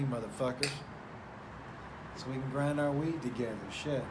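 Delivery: ordinary talk, nothing marked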